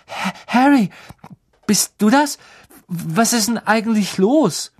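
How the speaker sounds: put-on a panicky boy stuttering apologies; stammering Neville. A narrator's reading. Neville stammering after crashing into the compartment.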